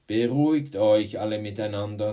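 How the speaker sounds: calling for order. Acted. Teacher calming quarrelling class, loud and commanding. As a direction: talk firmly over squabbling pupils.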